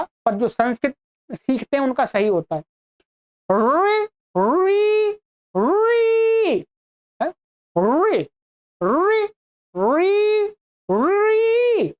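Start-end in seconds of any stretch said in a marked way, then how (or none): 3.5-6.7 "रे" as trilled vocalic ऋ, rising high and held; correct retroflex vowel demo. Shown as a demonstration. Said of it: "इसका उच्चारण तो प्राय करके अशुद्ध ही". 7.8-11.9 "रे" as trilled vocalic ऋ, rising high and held; correct retroflex vowel demo. Shown as a demonstration. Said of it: "मूर्धा में मुड़ लगनी चाहिए जीव".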